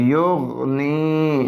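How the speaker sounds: युगनी very slow, syllables joined; युगनी said slow and whole; joined word. Shown as a demonstration. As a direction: stretch out युगनी. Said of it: "नून ज़ेर यानी".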